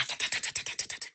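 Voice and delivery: soft unvoiced ta ta of a moving dress; whispered ta ta noise. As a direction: tick out the dress sound in a whisper.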